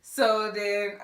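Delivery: ordinary talk, nothing marked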